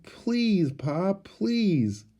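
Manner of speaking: put-on high, urgent childish pleading; begging please. A narrator's reading. Little girl pleading with Pa, high begging. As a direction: lift each please up high. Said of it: "Laura begged".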